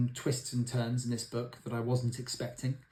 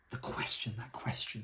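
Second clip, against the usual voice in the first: whispered the question, that question; soft, intimate stress on question; hushed emphasis; 7 dB quieter than usual. Shown as a demonstration. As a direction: lean in and breathe the question. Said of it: "to focus on that word, the question".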